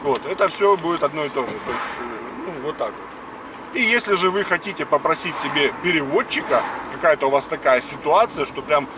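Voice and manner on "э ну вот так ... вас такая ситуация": ordinary talk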